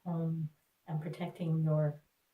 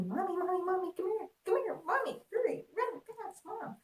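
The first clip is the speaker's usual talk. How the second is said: put-on high, urgent child calling for mommy; imitating her little son calling her over from the front yard; little boy calling; 11 semitones higher than usual. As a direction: call out like an excited small boy. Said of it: "he's calling me".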